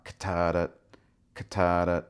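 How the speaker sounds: katata with a clear K, T and D; crisp katata tonguing demo; katata syllables. Shown as a demonstration. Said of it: "a K and maybe a T sound and maybe a D sound".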